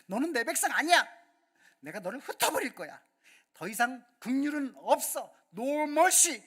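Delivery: loud, high, angry shout; furious divine rebuke. Acted. God rebuking his people in a furious shout. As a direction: thunder the rejection at the people. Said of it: "이렇게 말할 정도로".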